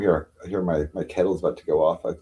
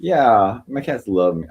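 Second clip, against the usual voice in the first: normal talking voice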